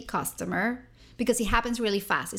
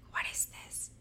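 soft unvoiced whisper; hushed confusion; 13 dB quieter than usual. Caller startled by the mother's accent, whispered. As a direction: whisper it so the caller can't hear.

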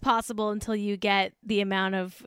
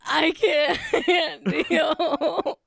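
high, slow words broken by laughing; real helpless laughter; 6 semitones higher, much slower than usual. Real, not acted. Host cannot cope with Jack's impression, helpless laughing. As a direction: give up, overcome with laughter.

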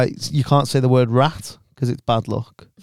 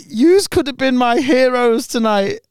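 higher, slow, wounded Irish fan; mock tearful; 9 semitones higher than usual. Fan complaining to comedians, mock tearful and hurt. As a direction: plead it like he's nearly in tears. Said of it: "nearly crying".

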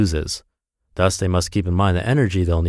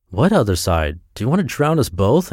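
plain reading voice

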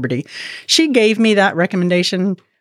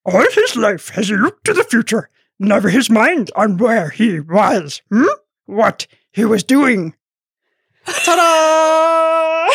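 slow, wise little Yoda voice with twisted word order; Yoda impression; 3 semitones higher, slower than usual. Showing off her talent to listeners, Yoda voice. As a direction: croak it out like Master Yoda, slowly. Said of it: "a very sick impersonation of Yoda".

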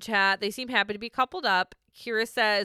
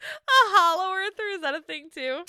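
laughing disbelief; 9 semitones higher, 4 dB louder than usual. Real, not acted. Incredulous laughing question.

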